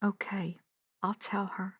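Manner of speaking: whispered, giving in; resigned whisper. Acted. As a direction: let it out in a whisper. Gives in after advice.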